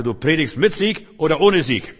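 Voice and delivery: laut, mit gehobenen Betonungen auf SIEG; nachdrückliche Ermahnung. Retold or imitated. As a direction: den Befehl kraftvoll herausrufen. Zitierte, energische Ermahnung zum Predigen. (laute, eindringliche Ermahnung)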